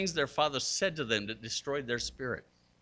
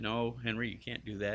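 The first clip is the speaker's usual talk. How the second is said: softer, lower voice of a patient dad; very calm; 3 semitones lower, 8 dB quieter than usual. Retold or imitated. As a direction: correct the child quietly and evenly. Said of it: "Eric very calmly said".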